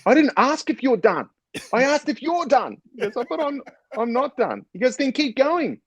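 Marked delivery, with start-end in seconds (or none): 0.1-2.8 very high, exasperated mock outburst; squeaky-high retold indignation; angrier staff. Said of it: "staff's getting angry and angrier". 3.3-4.6 high, stammered protest; high-pitched, hesitant retold objection; protesting trainee. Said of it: "Simon Dunn put up his hand". 5.0-5.8 very high, exasperated mock outburst; squeaky-high retold indignation; angrier staff. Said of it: "staff's getting angry and angrier".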